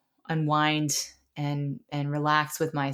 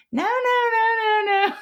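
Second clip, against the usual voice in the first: put-on much higher, louder childish whine refusing; whiny little boy; 6 dB louder, slower than usual. Mother imitating her son refusing meditation, high whiny wail. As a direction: whine no over and over like a stubborn kid. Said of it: "he was always like".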